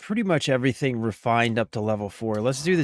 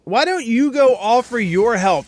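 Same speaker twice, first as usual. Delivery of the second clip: exasperated rant; 6 semitones higher, 5 dB louder than usual. Real, not acted. Ranting at the game character.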